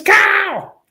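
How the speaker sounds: high-pitched coach voice hailing a recruit; Coach Spurrier impression. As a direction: greet him like an excited head coach. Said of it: "That's how he talks".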